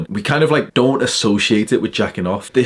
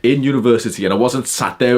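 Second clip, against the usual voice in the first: ordinary talk, nothing marked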